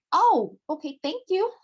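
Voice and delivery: light mock gratitude; delighted high thanks. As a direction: pitch jumps up with a grateful oh. Thanking for money, retold.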